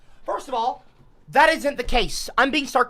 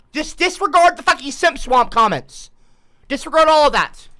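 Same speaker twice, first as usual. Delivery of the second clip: raised, loud, fed-up demand; exasperated order; 8 semitones higher, 9 dB louder than usual. Acted. Streamer orders viewers to drop Simp Swamp, exasperated. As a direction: insist they forget it, voice raised.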